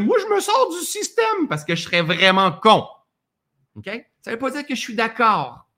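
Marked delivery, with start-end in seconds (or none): 0.0-1.5 mock defiant quote. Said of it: "je vais dire".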